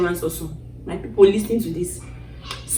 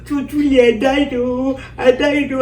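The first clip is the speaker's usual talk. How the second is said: crying lament; 4 semitones higher than usual. Retold or imitated. Woman retelling seeing herself dead, crying lament. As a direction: act out weeping over your own death. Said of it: "That's how I'm crying".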